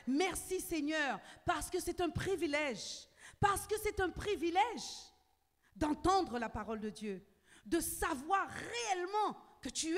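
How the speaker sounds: loud, high, fervent sermon voice; loud, rousing preacher; full-force preaching. Acted. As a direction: preach it out to the whole church with fire.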